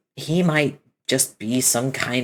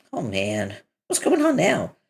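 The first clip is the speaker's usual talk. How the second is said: ordinary delivery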